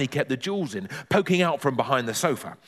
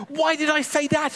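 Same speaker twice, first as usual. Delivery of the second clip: high-pitched self-reproach; dismayed high boy voice; panicked thought; 14 semitones higher than usual. Acted. Boy in terrible panic scolds himself, high dismay.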